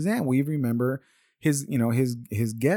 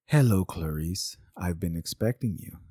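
Hannibal Lecter; 4 semitones lower, 4 dB quieter than usual. Retold or imitated. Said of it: "and Hannibal says".

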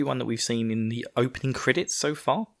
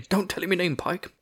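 higher, mock panicked officer blurting; hammed-up flustered captain; 8 semitones higher than usual. Captain blurting to the private in front of a German, hammed-up fluster. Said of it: "one of the young privates gets kidnapped".

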